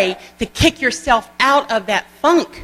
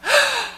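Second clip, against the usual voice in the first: sharp high delighted gasp; excited gasp. Retold or imitated. Salon lady gasping at meeting a Mary Kay girl.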